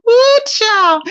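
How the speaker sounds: loud, high whoop through laughter; laughing whoop. Real, not acted. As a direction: let the laugh burst out. Laughing hard at the stream.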